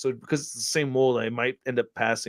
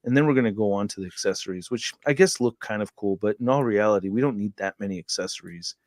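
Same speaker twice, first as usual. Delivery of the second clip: mocking bored youth; 6 semitones lower, 3 dB quieter than usual. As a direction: lower and softer, low-energy mumble. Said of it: "they always talk like this".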